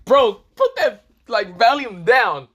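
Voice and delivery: loud, high-pitched protest; exasperated complaint. Acted. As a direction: call out over deafening noise. Acted complaint about loud volume.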